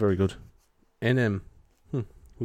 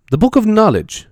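grand announcement; 12 semitones higher, 5 dB louder than usual. Acted. Announcing a book title grandly.

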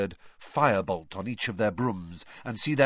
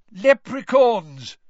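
high, loud, slow announcement; excited cry; 9 semitones higher, 9 dB louder, slower than usual. A narrator's reading. Mr Weasley calling out over the applause. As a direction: call it out over the crowd. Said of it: "said Mister Weasley".

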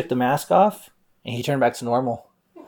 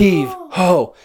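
high, loud heave of effort; straining shout; 8 semitones higher, 3 dB louder than usual. Acted. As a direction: yank and shout it like a tug of war. Tug of war over mask.

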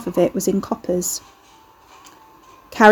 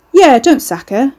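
raised, drawn-out backing-up; loud, higher, leisurely command; louder; 5 semitones higher, 7 dB louder than usual. A narrator's reading. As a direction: chime in loudly and slowly. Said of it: "Ricky said louder".

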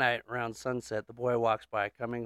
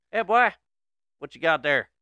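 raised-voice Southern holler; yelled out; 4 semitones higher, 6 dB louder than usual. Acted. Old man hollering at the neighbor kid, yelled. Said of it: "Yells out".

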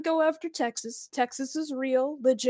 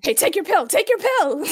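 loud hammed-up call; quoting the film with a loud mock yell; 6 semitones higher, 9 dB louder than usual. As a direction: bark it out like a panicked buddy. Quoting movie line loudly.

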